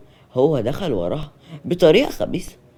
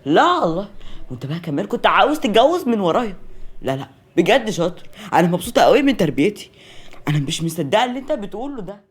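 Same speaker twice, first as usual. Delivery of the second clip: raised, higher indignant voice; indignant scolding; 5 semitones higher, 5 dB louder than usual. Acted. Mother confronting son over secret marriage, indignant. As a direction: scold with sarcastic reproach.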